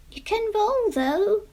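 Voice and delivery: high, small voice from below; a tiny earthworm chipping in; little worm voice. Acted. The worm speaks up to Jemima, small and high. Worm's voice.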